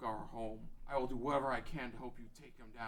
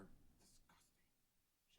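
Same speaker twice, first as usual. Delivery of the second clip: disgusted whisper; 31 dB quieter, much slower than usual. Acted. Darunia watches Zora being beaten.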